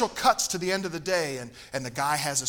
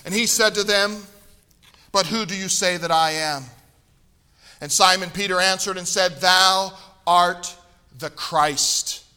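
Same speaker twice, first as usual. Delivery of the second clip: raised, steady reading voice; scripture reading; 3 semitones higher, 8 dB louder than usual. Acted. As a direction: read the Gospel aloud with weight.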